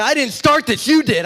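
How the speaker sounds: high, firm retold defiance; defiant. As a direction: refuse to back down. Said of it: "I'm not backing down".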